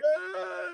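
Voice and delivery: high, soft, drawn wail; mock wail. Man retelling walking inside to his wife, high mock wail. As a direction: imitate your own hysterical wail, high and small.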